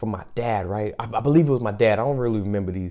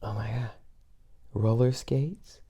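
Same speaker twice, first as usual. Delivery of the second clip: hushed gasp; 8 dB quieter than usual. Retold or imitated. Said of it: "and I said".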